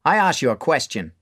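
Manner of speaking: bar owner. Acted. Mark questioning the boy: stern push. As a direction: press for an answer. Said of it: "says Mark".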